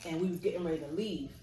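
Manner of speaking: ordinary delivery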